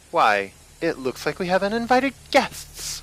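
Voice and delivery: a hungry woman mocking intruders; woman's voice. A narrator's reading. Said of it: "a woman voice rang out".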